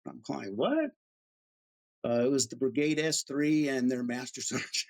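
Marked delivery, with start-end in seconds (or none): 0.6-0.9 high rising squeak of surprise; startled what. Retold or imitated. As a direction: caught red-handed, blurt it. Caught by surprise.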